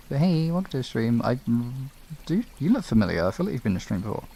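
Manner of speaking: ordinary talk, nothing marked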